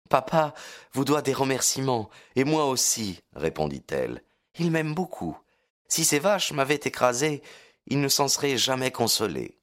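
put-on high, lively girlish voice; young girl voice. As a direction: voice Lucie bright and young. Lucie's voice in the story. (voix de jeune fille)

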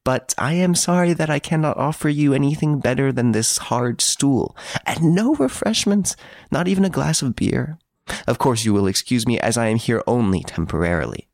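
lightly acted, lilting mock courtesy; airy gentleman prisoner. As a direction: play the courteous prisoner apologizing with flourish. Prisoner's dialogue in narrated story.

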